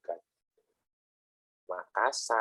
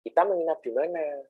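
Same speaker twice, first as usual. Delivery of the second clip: Makassar man asking; 7 semitones higher than usual. Retold or imitated. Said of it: "nyuruh sopirnya gitu".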